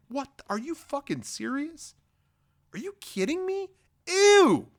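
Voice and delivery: hammed-up, very high-pitched incredulity; mock disbelief. Obi-Wan overhears the Separatists pledging allegiance, mock-shocked squeal.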